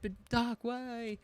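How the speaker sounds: mock-upset, high soft plea; softly imitating a hurt "why"; upset whine. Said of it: "she's very upset 'cause of Doc".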